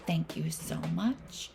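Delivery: soft whispered thanks. Real, not acted. Teacher thanks class, whispered.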